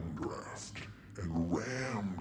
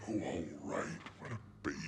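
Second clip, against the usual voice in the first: irritated grudging mutter, trailing off softer; 3 semitones lower, 5 dB quieter, much faster than usual. Acted. Stewardesses give in to nervous passenger, irritated mutter. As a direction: give in under your breath, annoyed. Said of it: "they were always rather irritated".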